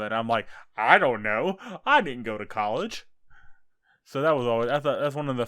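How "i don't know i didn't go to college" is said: loud, exaggerated clueless protest; mock bafflement. Retelling his reply to a random Java question, mock bafflement. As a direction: play up being totally stumped, with raised volume.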